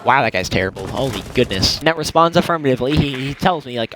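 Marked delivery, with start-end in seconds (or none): none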